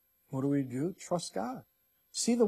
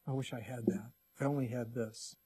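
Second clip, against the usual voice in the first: complaining; 3 semitones lower, 7 dB quieter than usual. Pretend. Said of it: "We're complaining".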